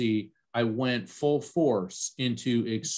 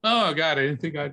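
suddenly overcome, higher voice breaking into tears; real choking up; 3 semitones higher, 3 dB louder than usual. Real, not acted. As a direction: caught off guard by grief mid-story. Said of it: "It's good to let it out".

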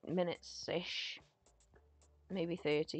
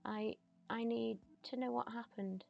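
slightly raised, halting plea; lightly voiced pleading daughter; 5 semitones higher than usual. Jane asks her mum how she died, lightly acted pleading. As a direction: plead gently with a dead mother.

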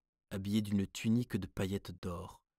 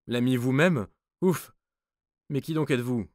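frightened guard; 5 semitones higher, 9 dB louder than usual. A narrator's reading.

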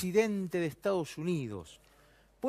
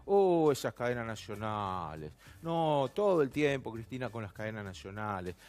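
mock complaint; 7 semitones lower than usual. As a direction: imitate a weary complainer, voice sinking low. Mimics complaints about national broadcasts.

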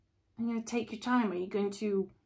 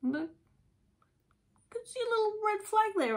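much higher put-on voice spotting trouble; mock realization; 13 semitones higher than usual. Listener noticing a red flag, in a much higher voice. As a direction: squeak it like a startled aside. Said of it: "You're like".

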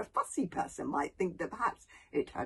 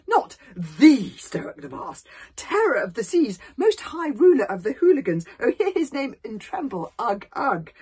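loud, grand proclamation; awed flattery; 5 semitones higher, 12 dB louder than usual. A narrator's reading. Alvin flattering Stoic as terror of the seas, awed. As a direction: hail the chief with exaggerated awe.

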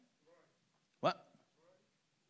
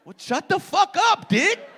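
loud mock snap; 6 dB louder than usual. Real, not acted. Heckling a heckler.

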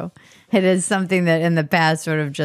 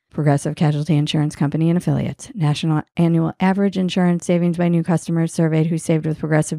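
ad disclaimer; 2 semitones lower, faster than usual. Acted. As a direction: rattle through the fine print evenly in a lowered pitch. Reads legal disclaimer.

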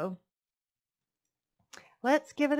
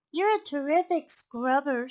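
higher piglet voice; flattering piglet; 6 semitones higher, 4 dB louder than usual. A narrator's reading. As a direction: praise the fox as a piglet. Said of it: "said the piglet".

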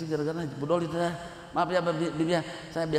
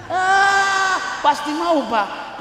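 loud, high mock shout; comic exclamation; 12 semitones higher, 7 dB louder, much slower than usual. Preacher telling the father the baby will agree, comic shout. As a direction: burst out high and loud for the punchline. Punchline of the acted threat.

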